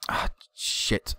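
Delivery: whispered swear under the breath; muttered curse. Real, not acted. Manager cursing quietly at his defense. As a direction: let it slip out under your breath. Another setback.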